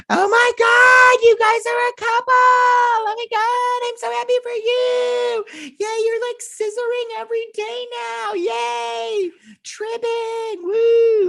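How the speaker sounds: very high squealing gush; squealing ditzy girl. Retold or imitated. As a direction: gush like an over-excited girlfriend. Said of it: "She's like".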